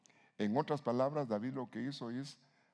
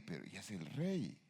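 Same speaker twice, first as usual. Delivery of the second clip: skeptical onlooker; 3 semitones lower, 6 dB quieter than usual. Retold or imitated. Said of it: "Alguien diría".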